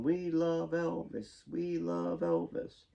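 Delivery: high, drawn-out imitation of distant fans; mock fan shouting. A narrator's reading. Fans calling to Elvis from a distance, restrained mock shout. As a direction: stretch it into a sustained, half-voiced crowd chant. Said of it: "several fans were shouting".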